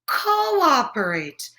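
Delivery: slow, drawn-out Cooperate again; patient correction, slowly; slow correcting word. Shown as a demonstration. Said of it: "Did someone say operate?".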